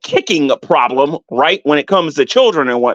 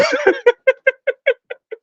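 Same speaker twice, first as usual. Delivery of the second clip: shrill mocking cackle; high cackling laugh. Real, not acted. Laughing high while mocking rivals.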